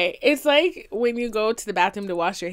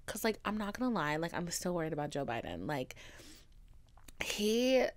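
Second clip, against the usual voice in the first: whisper; 3 semitones lower, 11 dB quieter than usual. Real, not acted. Confiding aside about Biden.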